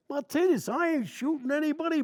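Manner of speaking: retold indignant yell; defensive shout. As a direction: shout back defending yourself.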